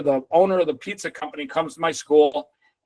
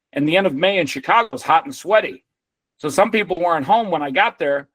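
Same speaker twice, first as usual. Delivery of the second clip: usual speaking voice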